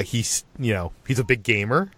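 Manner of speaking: usual speaking voice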